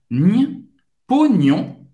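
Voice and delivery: nhe alone, then pognon built from it; sound then word. Shown as a demonstration. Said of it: "começando só com o som, depois com a palavra".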